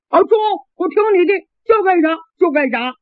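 eager lad. Acted.

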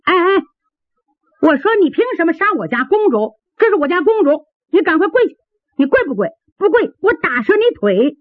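high-pitched scolding maid; maid shouting. Acted. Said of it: "春兰也喊".

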